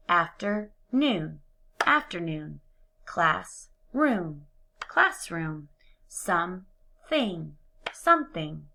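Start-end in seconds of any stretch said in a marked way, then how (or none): 0.1-1.4 slow, each syllable separate; syllables pulled apart. Shown as a demonstration. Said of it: "blend the two syllables together". 6.1-7.6 slow, split "Something" into two beats; syllables pulled apart. Shown as a demonstration. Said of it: "blend the two syllables together".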